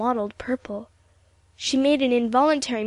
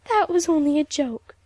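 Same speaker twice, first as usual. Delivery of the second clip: higher, tearful protest, mildly acted; sobbing; 5 semitones higher, 4 dB louder than usual. A narrator's reading. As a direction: protest through mock sobs. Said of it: "she sobbed".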